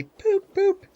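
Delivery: sung-out whistle imitation; engine whistle call. A narrator's reading. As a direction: toot it like a cheery train whistle. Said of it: "he never forgets to say".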